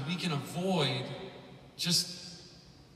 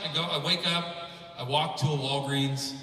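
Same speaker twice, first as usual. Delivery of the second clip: ordinary talk, nothing marked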